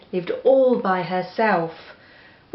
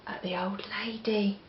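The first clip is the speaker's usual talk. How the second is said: whisper; 11 dB quieter than usual. Real, not acted. Pointing children to the picture.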